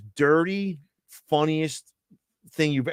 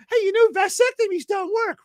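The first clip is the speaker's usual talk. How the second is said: much higher cute dummy voice; squeaky puppet voice. Acted. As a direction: chirp it like a tiny cute puppet. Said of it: "I say the next one".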